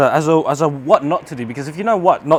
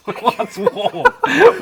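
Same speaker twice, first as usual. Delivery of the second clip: laughing; 11 semitones higher, much slower than usual. Real, not acted. Burned hand, laughing it off.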